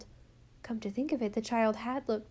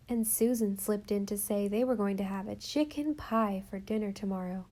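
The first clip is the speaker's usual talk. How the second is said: plain reading voice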